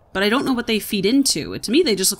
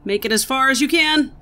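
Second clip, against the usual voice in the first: higher, louder push on CAN; urging on; 6 semitones higher, 5 dB louder than usual. Real, not acted. Player urging self on through a jump, raised and urgent. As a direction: push it out with rising effort.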